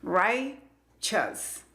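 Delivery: RIGHTEOUS split as "rai... chus", first syllable stressed; sílaba por sílaba. Shown as a demonstration. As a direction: break RIGHTEOUS slowly after "rai". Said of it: "es la que tiene el acento". (RIGHTEOUS separado: "rai... chus", lento)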